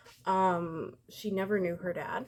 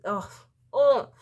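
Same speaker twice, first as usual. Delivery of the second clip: exasperated groan; 3 semitones higher, 9 dB louder than usual. Real, not acted.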